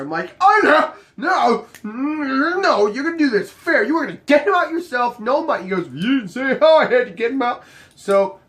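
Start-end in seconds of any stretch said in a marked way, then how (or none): 0.4-5.6 loud, high-pitched protest; hammed-up indignant objection; mock outraged refusal. Retold outraged refusal of bribe. 5.9-7.6 high, loud protest; pitched-up retold objection; mock argumentative comeback. Crew member's retold argument back.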